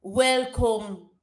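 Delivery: halting, badly faked Jamaican; clumsy attempt at a Jamaican accent; horrible Jamaican accent. Shown as a demonstration. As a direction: copy a Jamaican accent slowly and get it wrong. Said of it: "what I'm doing is just horrible".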